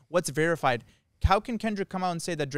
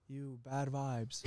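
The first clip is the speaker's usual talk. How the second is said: [name] impression; 12 dB quieter, much slower than usual. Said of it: "He's like".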